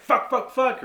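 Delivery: high, loud burst of pain curses; cathartic swearing. Shown as a demonstration. Someone who just got hurt, loud pained cursing. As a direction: yelp them out like you just got hurt. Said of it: "if you get hurt and you're like".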